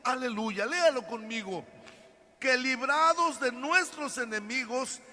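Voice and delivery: preacher exhorting the congregation; preaching. Acted. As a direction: drive the message home. Preaching at full force.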